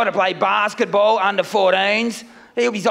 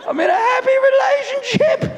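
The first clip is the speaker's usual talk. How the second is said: much higher, strangled and laughing; strained high protest; 13 semitones higher than usual. Acted. Comic insisting to the crowd he's happily married, in a strained laughing squeak. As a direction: squeak it out, unconvincing.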